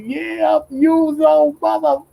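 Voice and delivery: high, put-on anime character voice; imitating a character. Anime fighter confronting the surrounded hero, high mock voice. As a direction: squeak it out high like a cartoon tough guy. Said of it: "He's like".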